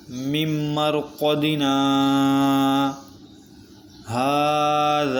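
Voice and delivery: slow sung recitation with a pause before هَذَا; Quran recitation. Shown as a demonstration. Teacher recites the third example, slow chanted recitation. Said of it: "اگلا حرف ہے".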